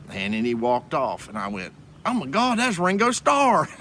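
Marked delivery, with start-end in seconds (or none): 2.0-3.7 high-pitched star-struck outburst; star-struck amazement. Retold or imitated. Fan reliving spotting [name] in a camera shop, star-struck. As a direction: re-enact the thrill of recognizing a star.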